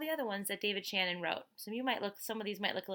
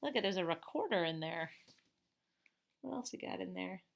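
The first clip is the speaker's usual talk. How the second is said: ordinary delivery, nothing marked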